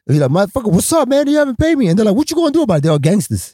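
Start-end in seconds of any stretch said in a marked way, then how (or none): 0.5-1.9 angry confrontation. Retold or imitated. Confronting boss about unpaid wages. 2.2-2.8 taunting gangster. Retold or imitated. Gangster boss taunting him.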